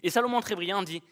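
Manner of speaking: ordinary delivery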